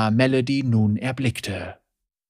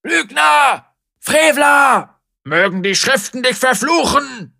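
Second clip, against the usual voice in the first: angry shout; 11 semitones higher, 7 dB louder than usual. Acted. Crowd member yells at heretic.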